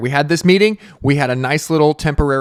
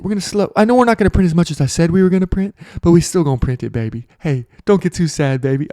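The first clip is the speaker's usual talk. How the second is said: soothing Powell voice; 3 semitones higher, 5 dB louder than usual. Retold or imitated. Powell soothing the markets, mock crooning sweet-talk. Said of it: "talking in a voice like this".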